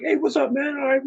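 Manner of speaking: high-pitched, casual hallway hello; friendly jock. Acted. Friendly jock greeting someone in the hallway, high and easygoing. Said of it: "he was a friendly jock".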